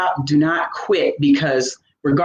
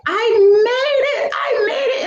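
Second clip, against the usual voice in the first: high, crying-laughing shout of victory; tearful triumphant shout. Retold or imitated. Runner crossing the finish line, shouting through tears of joy. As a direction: cry out I made it through happy tears.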